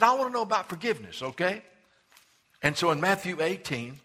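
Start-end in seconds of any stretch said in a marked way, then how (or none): 0.0-1.6 eager Peter. Acted.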